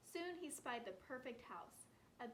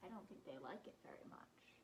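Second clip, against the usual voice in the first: soft unvoiced whisper to the listeners; whispered aside; 5 semitones lower, 9 dB quieter than usual. Real, not acted. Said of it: "teacher aside whispered".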